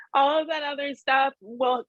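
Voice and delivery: high laugh-cry; real laugh through crying; laughing through tears. Real, not acted. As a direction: laugh while still teary. Laugh-cry mid advice.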